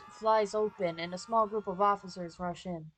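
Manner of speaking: normal talking voice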